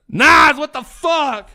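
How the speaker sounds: drawn-out high, loud yell of surprise; excited yell. Real, not acted. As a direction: stretch it out, high and loud, thrilled. Friend Nas joins stream.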